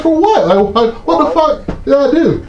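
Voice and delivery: stunned by the news of a warrant; shocked outrage. Retold or imitated. Told of a warrant.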